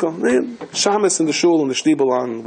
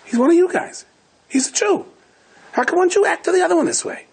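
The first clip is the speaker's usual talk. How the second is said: much higher, disbelieving reproach; a pirate baffled by the cruelty; incredulous pirate. Retold or imitated. Pirate scolding the innkeeper in a high incredulous voice.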